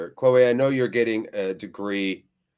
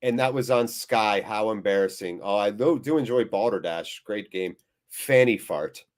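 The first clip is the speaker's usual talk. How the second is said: ordinary talk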